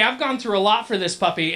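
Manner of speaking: ordinary talk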